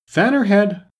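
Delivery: anxious interruption. A narrator's reading. Red Queen interrupting to get her fanned, anxiously. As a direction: cut in with urgent concern. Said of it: "the Red Queen anxiously interrupted".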